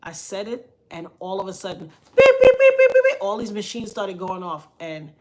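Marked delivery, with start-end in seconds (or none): 2.2-2.7 high loud imitated beeps; loud high beeping imitation; machine beeps. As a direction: mimic the alarms going off.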